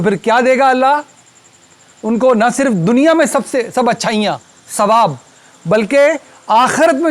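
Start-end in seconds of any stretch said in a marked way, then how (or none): none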